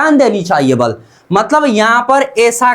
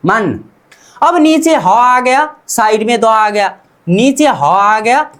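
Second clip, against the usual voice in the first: usual speaking voice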